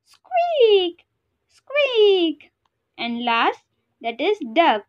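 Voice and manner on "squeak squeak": high, loud squeaks; squeaky mouse voice. Shown as a demonstration. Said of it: "the sound that mouse make".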